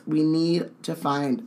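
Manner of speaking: plain reading voice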